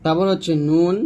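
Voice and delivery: ordinary talk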